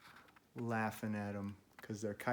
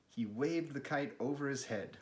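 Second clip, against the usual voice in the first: usual speaking voice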